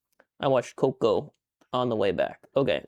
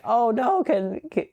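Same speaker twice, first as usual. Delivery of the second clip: high, fussy imitation of helpful strangers; mock concerned strangers; 10 semitones higher than usual. Mimicking airport strangers offering to hold the dog, high and fussy. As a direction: squeak out their eager offer. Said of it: "some people come over and they're like".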